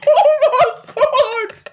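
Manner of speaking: high, loud, drawn-out words through laughter; real laughing fit. Real, not acted. Reader overcome by the absurd choking scene, helpless laughter. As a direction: wail it out while cracking up. Reader in fits over the story.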